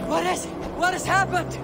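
high, loud frightened question; panicked. Acted. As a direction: demand answers in alarm.

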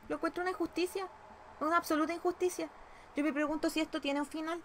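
high-pitched aggrieved complaint. Real, not acted. Complaining of injustice in the game.

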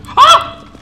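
short, high, loud startled yelp; sudden sharp scream of fright. Real, not acted. Player jumps at a game scare, startled scream.

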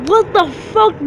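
mock outraged wrestler. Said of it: "Sasha Banks and Lita are like".

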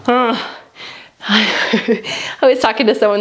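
usual speaking voice

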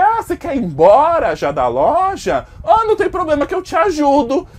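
loud, falsely sweet offer, pitch climbing high; mock helpful offer. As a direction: offer help with loud fake kindness. Narrator taunts a rude customer. (oferta falsamente gentil, em voz alta)

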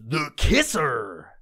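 slow, drawn-out station ident; radio DJ voice. Retold or imitated. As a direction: stretch the name like a DJ. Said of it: "why do they do that voice?".

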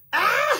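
high-pitched "ah" of effort; straining cry. Real, not acted. Vlogger struggling with the bag, straining cry.

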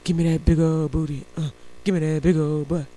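low, put-on lyric voice, half sung; lightly mocking a raunchy pop song; mock raunchy singer. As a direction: ham up a dirty song in a low voice.

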